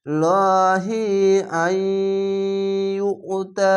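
slow, chanted recitation; Quran recitation. Shown as a demonstration. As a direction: recite লহি আইয়ুতা slowly joined. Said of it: "এভাবে reading পড়তে হবে". (টেনে তিলাওয়াত)

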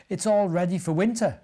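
ordinary delivery

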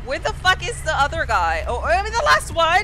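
loud high-pitched raging rant; raging gamer. Real, not acted. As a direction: yell at the game in disbelief. Realizes she was last alive.

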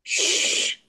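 a soft unvoiced shush. Shown as a demonstration. Teacher shushing the class to show an interjection. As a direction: hush the class with a breathy shh. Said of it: "It's as quiet as I can get".

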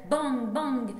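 two loud bangs, each falling; imitated gunshots. Poet voicing cowboys shooting from horseback, loud mock gunfire. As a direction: fire the bangs off like shots.

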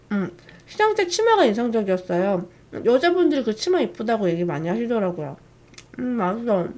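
eating while talking; talking while chewing. Real, not acted. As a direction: mouth full of cake. Seen 0: Bites cake from fork at 1.2s, then chews while speaking.

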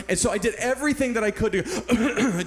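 ordinary talk, nothing marked

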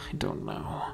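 very low, hushed mumble; dejected. A narrator's reading. Mika cools down after raging, quietly dejected. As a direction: let it sink away, defeated. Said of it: "dejectedly stated, cooling down".

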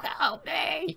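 lightly voiced ghostly cry for help; a hint of a wailing ghost; imitating the mother's astral cry; mock ghost plea. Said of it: "being like".

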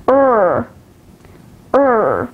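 r-coloured "er" as in word; isolated "er", the vowel of girl; vowel demo. Shown as a demonstration. Teacher showing learners the vowels, "er" as in girl. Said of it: "These are the short vowels".

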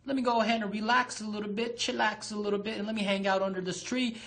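high, fast, cocky rabbit voice; a lazy show-off rabbit kicking back; brash hare who thinks he's already won; smug rabbit. Retold or imitated. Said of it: "he looked back and he was just like".

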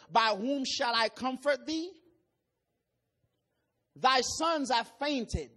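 measured, solemn preaching read; scripture reading. Acted. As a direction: pulpit reading of Isaiah. Reads scripture aloud in sermon.

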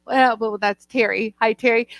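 ordinary talk

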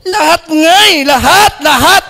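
high, loud, eager insistence; excited old woman volunteering; eager granny. Acted. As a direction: jump at the chance like a grandma keen to be chosen. Eager grandmother's punchline.

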